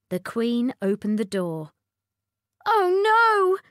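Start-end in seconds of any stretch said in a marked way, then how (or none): 2.7-3.6 high, loud dismay; wailing cartoon princess. A narrator's reading. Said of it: "said the princess".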